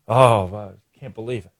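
low, soft mock lament; mock Quinn. Host mocks Quinn's self-blame in a low, soft mock lament. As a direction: sigh it out like a self-pitying coach. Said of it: "Dan Quinn".

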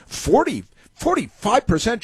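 a radio host mocking an anchor's shock; mock astonishment. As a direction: parody her stunned disbelief. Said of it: "she's astonished".

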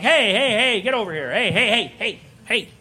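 high, urgent calling out; mock referee breaking up trouble; acted referee. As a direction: call the kids off like a referee. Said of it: "now I'm the referee".

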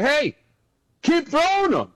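high, sarcastic coach taunt; mocking coach. Coach taunting a rookie who keeps throwing picks, high mocking jeer. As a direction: needle the kid in a high mocking voice.

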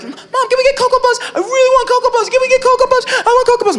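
put-on high pleading little-kid voice; high whiny child begging for cereal; whiny kid brother. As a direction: beg mom like a small child. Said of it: "my brother would be like".

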